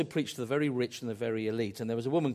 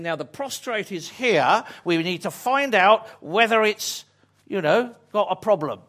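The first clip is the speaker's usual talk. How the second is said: NHS doctor; 5 semitones higher, 8 dB louder than usual. Retold or imitated.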